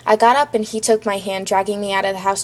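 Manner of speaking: ordinary delivery, nothing marked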